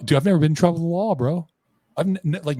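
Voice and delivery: acted-out rapid, high-pitched insistence; pleading protest. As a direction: protest your innocence in a rush. Retold panicked protest to his buddy.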